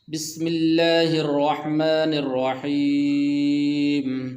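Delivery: slow melodic tajweed chant; Quran recitation. Shown as a demonstration. Teacher opening a Quran lesson with slow melodic recitation. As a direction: draw out each word in a slow chant.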